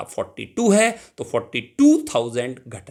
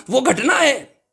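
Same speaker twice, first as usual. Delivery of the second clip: घटना said much higher and louder with a sharp fall; emphatic definition; 12 semitones higher, 6 dB louder, slower than usual. Shown as a demonstration. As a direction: punch घटना high like a teacher's cue. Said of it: "teacher drives home definition". (घटना पर ऊँचा ज़ोर)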